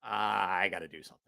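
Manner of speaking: low, soft groaned resolve; quiet acted-out dismay; retold dismay. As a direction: drop low and quiet, as if talking to yourself. Acting own dismayed reaction.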